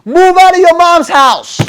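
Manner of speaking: high, loud and slow, yelling at his younger self; slow shouted order to his teenage self; loud command. Retold or imitated. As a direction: bark the order slowly and high. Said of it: "would have told myself".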